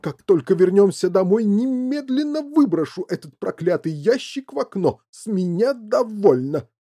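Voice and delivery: loud, high fed-up father; rant, slowing down for the final word; angry shouting. A narrator's reading. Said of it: "закричал мистер Ти Ви".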